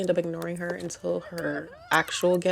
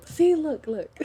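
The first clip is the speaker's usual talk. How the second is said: excited; 10 semitones higher, 5 dB louder, slower than usual. Real, not acted. Pointing out the jumper excitedly.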